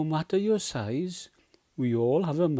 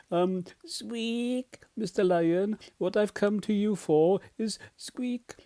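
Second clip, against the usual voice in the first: higher-pitched, hesitant little mouse voice; timid mouse; 7 semitones higher than usual. Acted. Mouse nervously asks sleeping lion to teach him to roar, timid squeaky voice. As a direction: squeak out a polite, nervous request.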